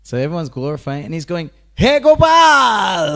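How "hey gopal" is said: loud, high, drawn-out shout across a crowd; a cowherd boy hollering his friend's name in delight; joyful call. Retold or imitated. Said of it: "Like this".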